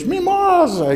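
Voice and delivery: very high, loud call; shouted call. Acted. Boy calls his goat at the valley entrance, shouting. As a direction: cry the name out into the valley.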